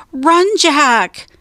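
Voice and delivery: high urgent warning shout. A narrator's reading. Annie sees pirates land near Jack, high urgent shout. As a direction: yell a warning across a distance. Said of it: "shouted Annie".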